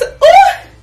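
loud high squeal of delight; excited ooh. Real, not acted. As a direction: squeal over the shoes. Showing the shoes.

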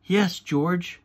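lightly bossy, telling a sibling off. A narrator's reading. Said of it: "in a bossy voice".

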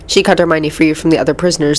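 plain reading voice

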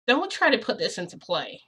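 insistent retold objection; firm warning.

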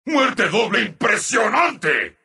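normal talking voice

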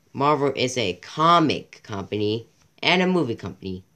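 ordinary talk

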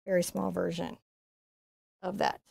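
quiet. Real, not acted. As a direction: drop to a hush for the small stroke. Painting small strokes softly.

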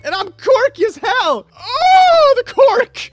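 very high squeaky falsetto, building to a wail; hammed-up falsetto gushing; mock quirky girl. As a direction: squeal it like an over-excited teen. Acts out a quirky girl's post.

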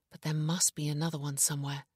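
usual speaking voice

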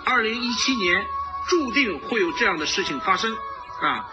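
ordinary talk, nothing marked

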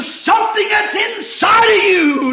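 high, loud preacher's cry; impassioned preaching shout. Real, not acted. Preacher telling the congregation what counts inside, impassioned shout.

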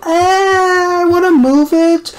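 high, whining little complaint; whiny voice. Retold or imitated. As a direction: whine it like a fussy kid. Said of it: "I'm about to go".

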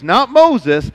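normal talking voice

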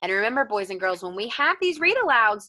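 ordinary talk, nothing marked

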